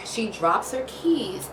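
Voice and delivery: normal talking voice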